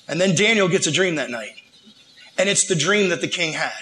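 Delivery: usual speaking voice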